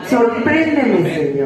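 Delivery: slow, stretched plea; drawn-out prayer. Acted. Preacher models a prayer to God, drawn out. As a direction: linger on each sound.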